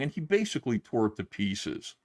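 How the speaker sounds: ordinary delivery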